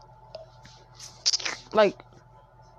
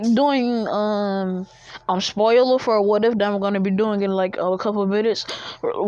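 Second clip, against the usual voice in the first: ordinary talk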